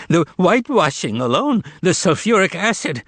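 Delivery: high, panicky babble; terrified babbling. A narrator's reading. Terrified Mogarich talking nonsense, babbling. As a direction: babble excuses in fright. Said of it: "He started babbling some nonsense".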